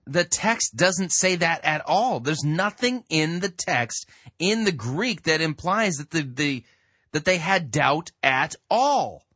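loud, slow, insistent denial; emphatic insistence. Real, not acted. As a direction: hammer it home. Rebutting the preacher again.

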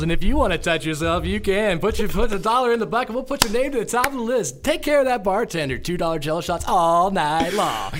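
loud, high hype announcer; karaoke DJ voice. Retold or imitated. Karaoke DJ calling up the next singer, loud and high. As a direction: work the bar crowd like a DJ. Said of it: "We're like".

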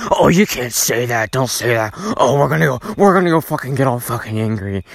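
put-on voice imitating outraged people; mock outrage. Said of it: "like to get all fucking outraged".

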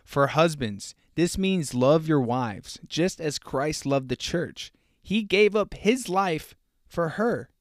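very slow, solemn Bible reading; host reading Ephesians to listeners, slow and solemn; scripture reading. Acted. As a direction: lay out each word of the verse slowly. Reads Ephesians aloud.

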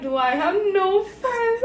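high, slow, teary imitation; mock crying. Retelling her sobbing question in bed, mock crying. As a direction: whimper it like a self-pitying kid. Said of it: "I literally was crying in my bed".

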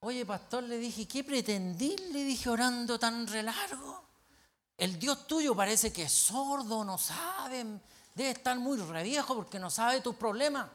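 high-pitched, incredulous telling-off; exasperated scolding. Retold or imitated. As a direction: scold a friend who kept you up all night. Acted scolding of the praying pastor.

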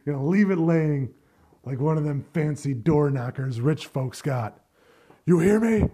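quoting the father's over-the-top revenge threat from the film, loud and theatrical; dramatic movie threat. Retold or imitated. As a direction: growl the revenge line like a grieving dad gone mad. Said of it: "this super, like, dramatic line".

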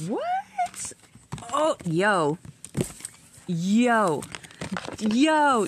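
startled, pitch leaping up; stunned surprise. Real, not acted. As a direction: gasp then high amazed yelps. Shocked by a drawn card.

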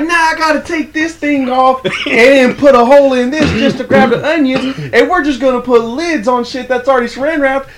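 high, loud mock rant as Willie; imitating Willie. Coworker mimics Willie ranting about saran wrap, high and loud. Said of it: "He was like".

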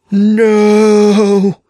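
huge, high, loud drawn-out wail. A narrator's reading. The dark man wailing as doom arrives, high and loud. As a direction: wail in total terror. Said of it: "the dark man wailed".

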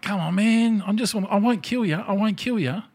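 put-on high, pleading young gamer voice; a high, pleading imitation of a young gamer. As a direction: beg him to spare you. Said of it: "And he's like".

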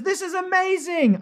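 high, loud burst of amazement; excited exclamation. Retold or imitated. Host reading a viewer's comment to the stream, excited exclamation.

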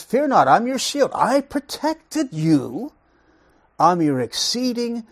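high, emphatic voice of God, slowing down; God's proclamation. Acted. Preacher voicing God's promise to Abram, emphatic proclamation. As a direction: proclaim it as God, stretching the key words.

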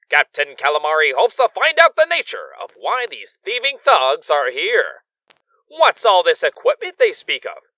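high, loud cliffhanger announcer; radio serial announcer. A narrator's reading. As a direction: sell the thrilling next episode. Radio serial narrator wraps up episode.

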